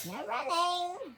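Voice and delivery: high, loud and slow; a sharp high reminder shouted down the hall; called after him. Acted. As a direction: call the library rule after him. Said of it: "called after him".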